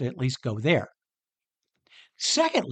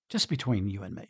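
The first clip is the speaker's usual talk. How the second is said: softer, lower, just between two friends; confiding aside; 6 semitones lower, 6 dB quieter than usual. Retold or imitated. Peter confiding to Jesus, softer and lower. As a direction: lean in and say it privately. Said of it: "Peter was kinda going to Jesus and say".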